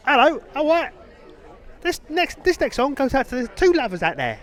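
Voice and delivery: put-on high, loud, cheery old-school DJ patter; cockney radio DJ impression. Old radio host mocked by his cohost, cheery high DJ patter. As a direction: ham up a cheeky London DJ intro. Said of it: "Now your proper Oliver Twist era".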